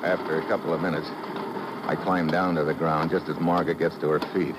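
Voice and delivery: low, steady narrator's voice; measured radio detective narration; story narration. A narrator's reading. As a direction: tell it as a hard-boiled recap. Calm narration after climax.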